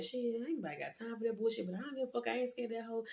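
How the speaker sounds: high, soft talk among the new inmates; hushed muttering. Retold or imitated. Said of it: "we just talking like".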